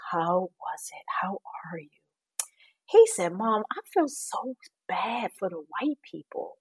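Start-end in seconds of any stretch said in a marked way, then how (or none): 0.1-1.9 quiet, almost whispered question; soft near-whisper; hushed. Retold or imitated. Mother asking son quietly. 3.3-6.6 put-on whispered, child-like voice; mock whisper of a young son; child's voice.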